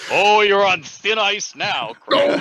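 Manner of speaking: loud, slow, teasing threat; mock warning. Real, not acted. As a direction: warn him, half joking.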